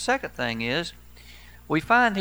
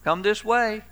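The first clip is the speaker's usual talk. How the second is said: raised, higher calling voice; mild mock calling out; 8 semitones higher, slower than usual. Young pastor calling sheep across a field, raised voice. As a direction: sing out to the sheep, a little timid. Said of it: "And Bill said".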